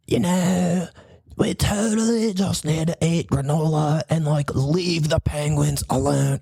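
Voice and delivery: slow, laid-back hippie voice; a hint of a dreamy granola type; lightly voiced mellow tree-hugger.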